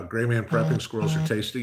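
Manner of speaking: ordinary delivery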